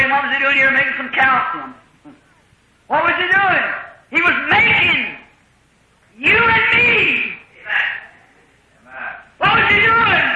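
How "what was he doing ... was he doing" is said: rising, slow, high-pitched preacher's cry; preaching climax. Acted. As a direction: build the question up to a ringing cry. Preacher building to a peak.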